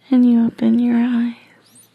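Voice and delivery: plain reading voice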